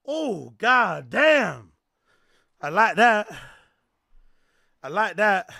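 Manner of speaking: loud, high excited outburst. Real, not acted. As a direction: hype it up, shouting. Excited reaction to a clip.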